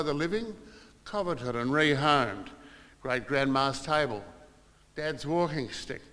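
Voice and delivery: recited memorial poem; poem reading. Acted. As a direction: read her poem out with feeling, measured. Reads Mum's poem.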